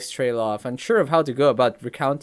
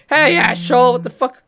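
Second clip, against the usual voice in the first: loud playful insult; rude shout; 12 semitones higher, 12 dB louder than usual. Acted. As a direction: yell it at a friend. Rudely answering phone.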